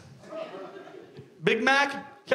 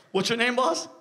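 ordinary delivery

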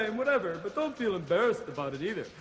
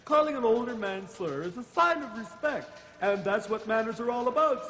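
usual speaking voice

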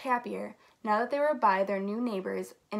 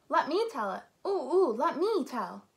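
swooping, excitable "pick me" plea; eager pleading; 4 semitones higher than usual. Acted. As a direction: beg for a turn like an excited kid. Characters clamor to tell the story.

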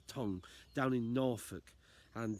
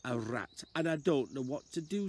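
high, louder anxious plea; pleading man; 7 semitones higher, 4 dB louder than usual. Acted. Unfit man begs the old lady downstairs, high anxious call. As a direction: call out to her, worried. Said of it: "He said".

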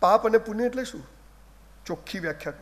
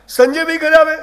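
punchline; 7 semitones higher, 6 dB louder than usual. Real, not acted. Repeated line, louder punch.

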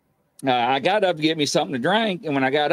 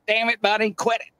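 higher-pitched exasperated telling-off; imitated scolding; 9 semitones higher than usual. Grandmother to late husband over lights, imitated scolding. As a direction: act out a fed-up grandmother, pitched up.

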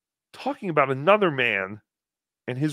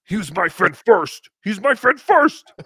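put-on louder, whiny jealous girlfriend; 5 semitones higher, 9 dB louder than usual. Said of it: "[name] being a jealous girlfriend".

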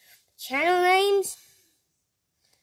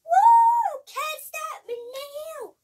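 high, slow, stretched-out hero cry; high heroic yell, dragged slow; Miles hero shout; 14 semitones higher, 11 dB louder, much slower than usual. Retold or imitated. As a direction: belt it like a superhero mid-jump. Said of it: "Miles goes".

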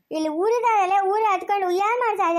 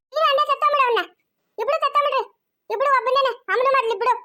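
angry outburst; 6 semitones higher than usual. Acted. Acted quarrel, cursing the man.

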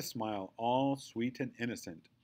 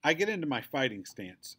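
ordinary delivery

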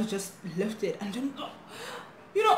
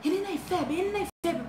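imitated shouting; 8 semitones higher, faster than usual. Intruders yelling at sleeping schoolgirls, mock shouting. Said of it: "they're shouting".

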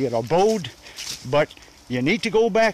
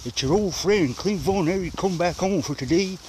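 usual speaking voice

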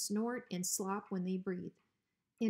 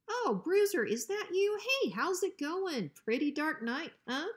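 much higher, louder, overly friendly greeting; fake cheer; 9 semitones higher, 5 dB louder than usual. Hank greeting the dangerous Rottweiler with forced cheer. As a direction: gush to cover your fear.

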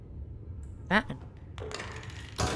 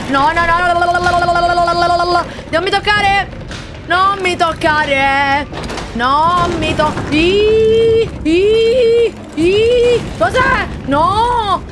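panicked high screams while fleeing; terrified cries, running for your life; being chased; 8 semitones higher, slower than usual. Real, not acted. Being chased in horror game.

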